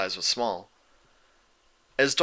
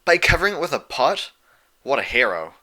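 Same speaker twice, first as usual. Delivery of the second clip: snickering; 4 semitones higher, 5 dB louder than usual. A narrator's reading. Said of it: "Rainbow Dash snickered".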